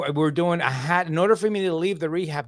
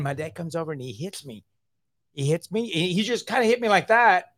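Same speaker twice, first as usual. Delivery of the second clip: ordinary talk, nothing marked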